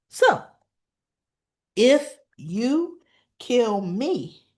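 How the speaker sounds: loud, halting, emphatic defiance; defiant challenge. Retold or imitated. Woman daring the boss who threatened her, defiant. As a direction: land each phrase separately, with long pauses.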